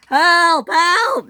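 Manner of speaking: high, loud squeals; cry for help. A narrator's reading. Both derailed engines cry for help. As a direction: yell for rescue in panic.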